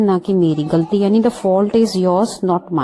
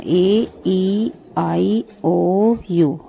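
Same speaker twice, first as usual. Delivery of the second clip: each vowel ए, ई, आई, ओ, यू said slowly and separately; slow vowel list; much slower than usual. Shown as a demonstration. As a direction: spell out the vowels slowly one by one. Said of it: "ये याद रखना, ये vowels होते हैं". (स्वर धीरे-धीरे एक-एक करके)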